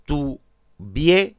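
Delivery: "Tuvierais" very slowly as "tu-VIE-rais"; "Tuvierais" syllable by syllable, diphthongs kept whole; split into syllables. Shown as a demonstration. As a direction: say "Tuvierais" in three beats, stressing "vie". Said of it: "se mantiene el diptongo".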